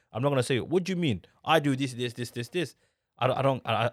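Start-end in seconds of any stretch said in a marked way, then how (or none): 0.6-2.8 angry. Acted. Husband showing the retort he avoids, mock angry. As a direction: act out a defensive comeback, rattling off the list. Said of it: "I'm not getting angry".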